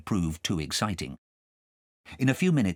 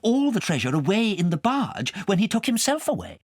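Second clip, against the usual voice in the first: a hint of a high anxious voice; lightly voiced questioner; 13 semitones higher, 3 dB louder than usual. A listener asking Abner, lightly voiced. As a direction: ask it lightly, a touch higher. Character voice in read story.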